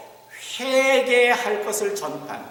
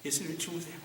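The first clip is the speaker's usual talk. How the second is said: soft title repeated; 2 semitones lower, 13 dB quieter, faster than usual. Shown as a demonstration. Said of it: "말씀의 제목을 이렇게 했어요".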